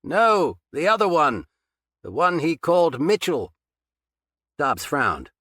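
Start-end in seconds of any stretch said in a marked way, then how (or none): 0.0-3.5 loud, higher voice calling from afar. A narrator's reading. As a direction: shout it across the basement. Captor calling across basement.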